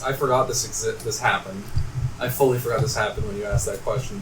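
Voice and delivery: ordinary talk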